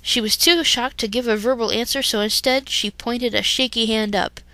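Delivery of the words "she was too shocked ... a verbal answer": plain reading voice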